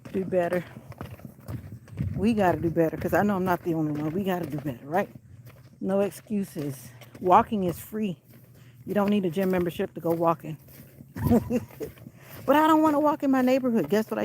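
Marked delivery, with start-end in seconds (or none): 5.8-8.2 slow slogan. Real, not acted. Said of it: "motivational slogan".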